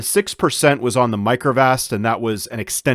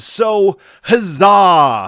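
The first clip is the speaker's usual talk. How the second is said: drawn-out high huzzah, louder; slow, hammed-up huzzah; mock cheer; 7 semitones higher, 6 dB louder, much slower than usual. Mock celebration of losing portfolio.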